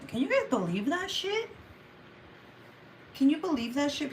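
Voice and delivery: high-pitched, incredulous complaint; indignant disbelief. Real, not acted. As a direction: ask it high, appalled and disbelieving. Indignant about being mocked for her voice.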